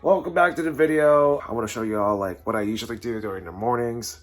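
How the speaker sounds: loud, high cheerful narration; host it with energy; upbeat vlog host. Acted. Upbeat morning vlog narration over music.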